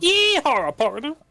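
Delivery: high whooping cowboy call; cowboy yeehaw. Pretend. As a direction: holler it like a rodeo cowboy. Mocking the cowboy impression.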